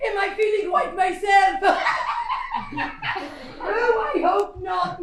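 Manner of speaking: loud giddy laughing; laughing delight. Acted. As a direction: laugh at the question with joy. Delighted with the housekeeper.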